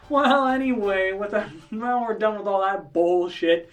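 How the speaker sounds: hammed-up game-show host voice; showman host. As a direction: welcome the crowd like a game-show emcee. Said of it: "He goes".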